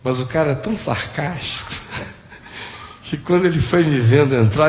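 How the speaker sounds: normal talking voice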